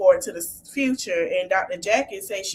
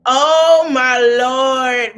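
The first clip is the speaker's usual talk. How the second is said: drawn-out oh my Lord; 3 semitones higher, 8 dB louder, much slower than usual. Real, not acted. Host reacting to on-screen argument.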